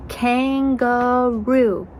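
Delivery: "Kangaroo" slowly in three beats; KAN, GA, ROO, slow and separate; split into syllables. Shown as a demonstration. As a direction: stretch each syllable of "Kangaroo". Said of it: "Let's clap that out".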